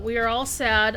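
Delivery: usual speaking voice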